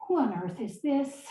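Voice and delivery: ordinary talk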